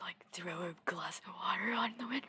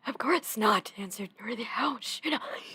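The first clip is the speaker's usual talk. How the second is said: emphatic, louder denial; loud retort; 3 semitones higher, 8 dB louder than usual. A narrator's reading. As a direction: fire back the answer loudly. Acted reply to wailing witch.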